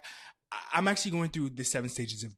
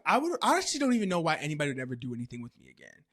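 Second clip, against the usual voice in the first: usual speaking voice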